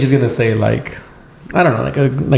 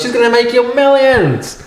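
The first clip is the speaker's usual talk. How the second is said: put-on high, slow doting old lady; a lightly voiced granny promising big things; mild old-lady gush, pitched up; 9 semitones higher than usual. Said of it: "and she goes".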